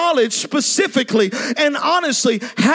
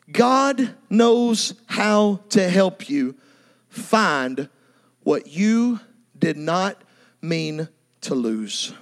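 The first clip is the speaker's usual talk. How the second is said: reassuring the congregation God restores; slow tender assurance; 7 semitones lower, much slower than usual. Real, not acted. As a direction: let each word land gently. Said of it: "preacher's key line".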